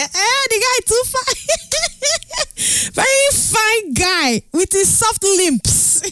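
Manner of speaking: high, gushing swoon over a handsome guy. Real, not acted. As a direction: melt as you rave about him, slow on the lips line. Swooning over the singer's looks.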